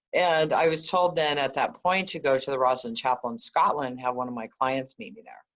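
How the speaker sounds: normal talking voice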